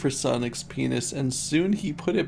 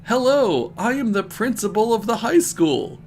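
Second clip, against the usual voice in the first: high, loud self-introduction; important principal; 7 semitones higher, 4 dB louder than usual. A narrator's reading. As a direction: announce yourself as someone important. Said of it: "in an important way".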